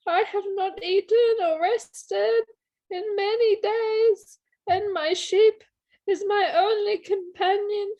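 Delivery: high, hammed-up begging; mock-tearful, wailing appeal; pitiful pleading. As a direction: plead like a starving traveller playing for pity. Player acts pleading hungry traveller.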